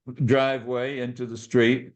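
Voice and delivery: ordinary talk, nothing marked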